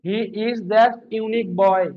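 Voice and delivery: very slow, every word drawn out for notes; slow dictation. Shown as a demonstration. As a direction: dictate the sentence slowly to the class. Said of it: "কি বললাম?". (খুব ধীরে শ্রুতিলিখন)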